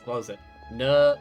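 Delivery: ordinary talk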